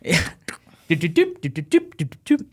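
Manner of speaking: high little dialing beeps; imitated keypad beeps. Storyteller dialing home on borrowed phone, imitated beeps. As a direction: mimic phone keys in rhythmic beeps.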